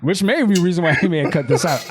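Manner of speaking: laughing through the words, quick; giggly aside about the cut scene. Real, not acted. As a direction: chuckle it out. Laughing at the trailer joke.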